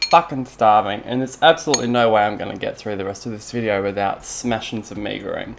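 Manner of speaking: normal talking voice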